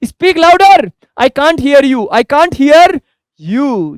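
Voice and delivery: high, loud shouted request. Shown as a demonstration. Teacher demonstrates the phrase to students, shouting it high. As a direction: shout it so they speak up. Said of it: "तो क्या बोलेंगे?".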